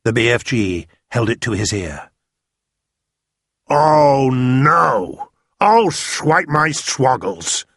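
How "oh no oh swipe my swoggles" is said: big giant's voice, higher and louder, slow and dismayed; a giant crying out in horror at what he hears; BFG dismayed. A narrator's reading. BFG to Sophie, aghast at the bad dream, giant voice.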